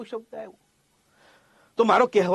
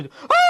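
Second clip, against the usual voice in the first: short high-pitched loud scream; sudden shriek as if startled in the dark; demonstrated scream; 5 dB louder than usual. Shown as a demonstration. Teacher showing what screaming in the dark is, short scream. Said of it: "ચીસ પાડવી એટલે આપણે કંઈક આમ".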